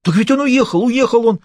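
a terrified man yelling his innocence at the boss; panicked shout. A narrator's reading. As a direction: shout it out in fear. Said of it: "Не кричи!".

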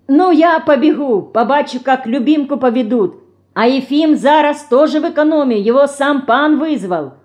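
calling out. A narrator's reading. Fedorka calls out while running off, shouting. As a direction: shout it back as you dash off. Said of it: "крикнула Федорка".

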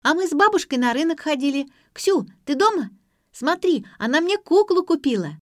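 put-on high, loud little-girl chatter; child's voice. A narrator's reading. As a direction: pipe up like an excited little kid. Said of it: "раздался детский голос".

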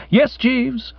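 strained, high-pitched agreement; struggling to get it out; speaking with difficulty. A narrator's reading. Bertie overcome by his valet's brilliant idea, speaking with difficulty. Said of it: "speaking with some difficulty".

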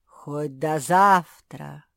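low, slow, drawn-out promise; slow lake voice. A narrator's reading. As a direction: speak as an ancient lake. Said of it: "пообещало озеро".

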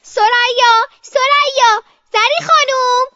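high, loud calling at a door; child's shrieked calls; screaming for help. Acted. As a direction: shout the names desperately.

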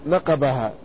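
نقبها said naqabaha, with b after the q; misreading with B. Retold or imitated. Reader finds the note in the margin, نقبها said naqabaha. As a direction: read نقبها with open a vowels and a clear b. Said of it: "كما ذكر".

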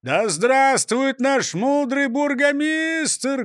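barked cheer. A narrator's reading. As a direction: bellow the toast. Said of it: "гаркнул прокурор Принцип".

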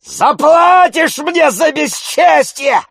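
slow, very high, loud parting yell; shouting. A narrator's reading. Tarantyev crossing the yard, shouting a parting threat. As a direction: bellow the threat back, stretching it out. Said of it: "кричал он, идучи по двору".

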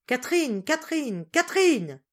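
small piercing voice calling, high and loud; high calls rising in urgency. A narrator's reading. Breathless Mathilde calls the missing servant, high piercing cries. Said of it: "mademoiselle Mathilde appela".

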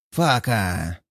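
frustrated creature hissing under its breath; upset hiss. A narrator's reading. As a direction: spit the word out in a sulky hiss. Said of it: "расстроенно шипит Гаргона".

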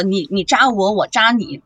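normal talking voice

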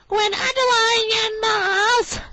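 put-on very high, slow squeaky girl's voice; high, slow mock-reading voice; impression of Beverly. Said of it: "she speaks like this".

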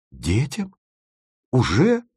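rising, taken-aback echo; startled questions. A narrator's reading. Timofeev learns of future children, startled. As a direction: query each word in surprise.

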